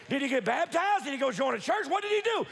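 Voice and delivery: ordinary delivery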